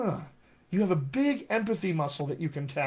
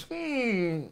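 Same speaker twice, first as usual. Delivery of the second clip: brain talking; 2 semitones higher than usual. Acted. Brain to itself on arriving among other accents, musing and uneasy. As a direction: voice the brain noticing it doesn't fit in. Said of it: "your brain goes".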